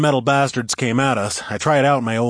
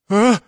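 sharp intake before a sneeze; gasping into a sneeze; sneeze buildup; 12 semitones higher, 7 dB louder than usual. Acted. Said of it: "I started to sneeze".

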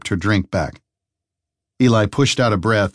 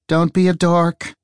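lightly voiced woman detective; slow, dry female detective voice; 9 semitones higher than usual. Detective scolds the lumberjack.

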